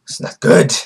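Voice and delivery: hammed-up alarmed fox, opening on a gasp; panicked fox. Vlogger playing the fox sensing Madara's chakra, mock alarm. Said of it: "And the fox is like".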